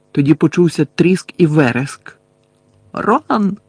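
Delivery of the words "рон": high-pitched call, volume held back; крик. A narrator's reading. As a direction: call out the name in alarm. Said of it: "закричав Гаррі". (стриманий крик)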